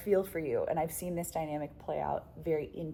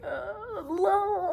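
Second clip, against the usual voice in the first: startled cry; 5 dB louder than usual. Retold or imitated. Startled by a hawk strike.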